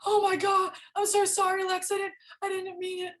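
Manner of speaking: high, loud, frantic apology; mock-panicked friend begging forgiveness. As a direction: squeal out a frantic sorry. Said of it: "He's just like".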